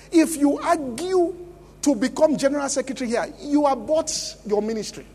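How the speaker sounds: loud, driving sermon delivery; full-force preaching. Acted. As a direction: preach it hard at the congregation.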